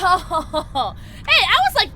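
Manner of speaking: high, loud words through laughter; real giggling protest; laughing. Real, not acted. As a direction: burst out laughing as you talk. Laughing about the chaos.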